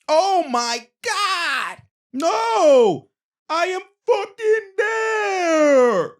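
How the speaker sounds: high, loud, drawn-out furious yell; slow outraged protest at the call; Lowry cussing. Retold or imitated. Lowry cussing at the ref, slow high furious yell. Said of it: "You can see Lowry cussing".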